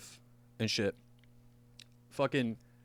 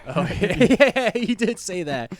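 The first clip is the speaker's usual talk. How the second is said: laughing; 10 semitones higher, 8 dB louder, slower than usual. Real, not acted. Laughing at the memory.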